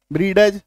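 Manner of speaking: ब्रीडज with an audible ड before ज; wrong pronunciation. Shown as a demonstration. Teacher shows what to reject, wrong pronunciation. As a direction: sound out the D in ब्रीडज. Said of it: "नहीं।".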